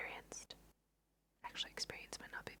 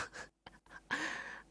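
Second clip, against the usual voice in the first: breathy, voiceless chuckle; whispered laugh. Real, not acted. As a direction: laugh under your breath, amused. Amused by the silly avatar expressions.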